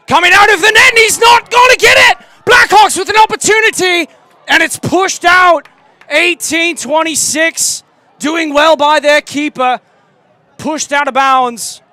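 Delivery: frantic English commentator shouting; excited shout. Pretend. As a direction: scream the goalmouth chaos in a British accent. Goalmouth scramble, live call.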